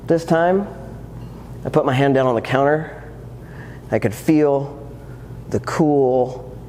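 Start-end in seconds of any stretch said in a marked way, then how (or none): none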